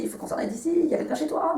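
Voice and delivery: high, contained mock cry of fear; high-pitched imitation of a scared friend; retold frightened cry. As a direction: imitate her panic without raising the volume. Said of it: "elle s'est mise à crier".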